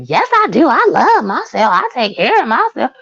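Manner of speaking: high, swooping mimicry of a defensive protest; mocking cliche answer. Women insisting they love themselves, mockingly imitated. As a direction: parody someone insisting in a singsong pitch. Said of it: "the cliche answer that they give".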